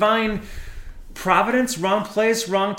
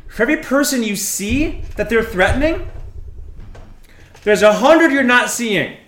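louder, higher, pressing hard; heated warning; 5 semitones higher, 6 dB louder than usual. Acted. Character lectures Norman on hidden threats, raised voice. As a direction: hammer each point home.

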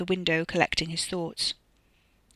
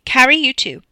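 loud, higher summons; announcing; 7 semitones higher, 12 dB louder than usual. A narrator's reading. Katie summons Carrie to the meeting, announcing loudly. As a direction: order someone along, raised voice. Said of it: "Katie announced".

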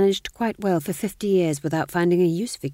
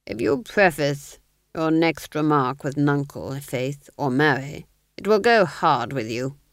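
menacing Duke; 3 dB louder than usual. Acted. Duke threatening the fool, cold and measured.

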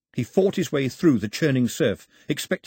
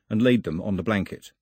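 plain reading voice

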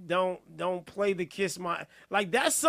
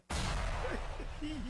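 breathless wheezing laugh; 7 semitones higher, 7 dB quieter than usual. Real, not acted. Host bursts out laughing.

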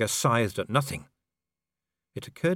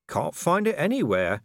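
innocent Vimes; 8 semitones higher than usual. A narrator's reading. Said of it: "said Vimes innocently".

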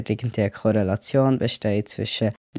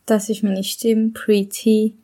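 higher, lighter voice from before hormone therapy; the old pre-T voice, clearly higher; pre-testosterone voice; 10 semitones higher, 3 dB louder, slower than usual. Shown as a demonstration. Speaker plays their voice from before testosterone, higher. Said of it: "so kann ich früher getönt".

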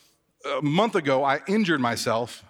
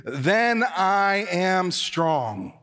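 slow unison line; 4 semitones higher, much slower than usual. Shown as a demonstration. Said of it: "say it with me".

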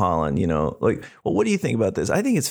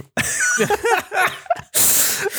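big laugh. Real, not acted. Laughing at own answer.

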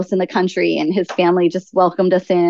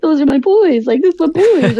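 high mock wail with a laugh creeping in; higher-pitched, whiny retold crying; imitated crying child; 8 semitones higher, 3 dB louder than usual. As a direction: imitate a small boy's tearful protest. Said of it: "Trucker starts crying".

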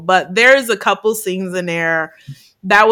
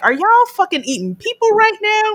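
much higher, incredulous question; shocked outrage; 11 semitones higher than usual. Retold or imitated. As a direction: squeal it in horror. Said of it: "I was like".

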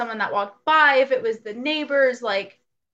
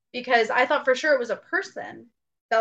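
ordinary talk

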